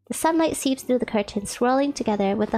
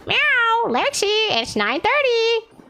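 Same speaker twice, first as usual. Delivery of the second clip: much higher slow cat voice; 10 semitones higher, much slower than usual. Pretend. Kitten reminding Lexi of the time, slow high cat voice. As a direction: drawl it out sweetly like a kitten.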